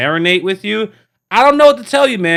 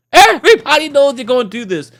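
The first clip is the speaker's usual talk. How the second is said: emphatic repeat; 7 semitones higher, 4 dB louder than usual. Shown as a demonstration. Repeated louder, higher.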